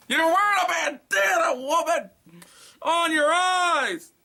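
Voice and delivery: very high, loud, slow shout; exasperated yell at the victim; outraged yelling. Real, not acted. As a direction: scream the obvious at her. Yelling at story character.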